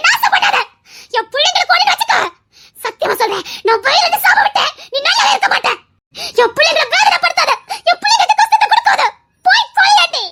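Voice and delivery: high furious shouting; screaming curse. Acted. Mother screaming a curse at the woman.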